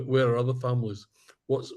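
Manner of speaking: ordinary talk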